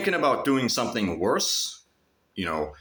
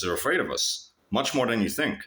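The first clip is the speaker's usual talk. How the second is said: ordinary talk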